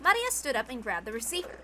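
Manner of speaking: ordinary delivery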